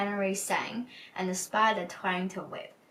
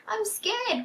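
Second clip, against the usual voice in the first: much higher, mock frightened wail; scared cry; 13 semitones higher than usual. Hugh scared by the spider's threads, hammed-up high wail. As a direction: play the big thief turning into a baby. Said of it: "cried Hugh".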